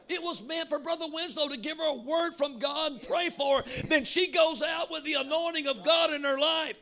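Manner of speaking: fiery preacher at full volume; full-force preaching. Acted. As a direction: preach it to the rafters, building.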